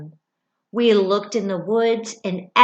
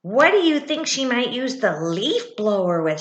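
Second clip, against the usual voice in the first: plain reading voice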